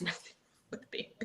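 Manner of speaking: whispered with a laugh; a breathy, giggly aside. Real, not acted. Self-deprecating aside, whispered.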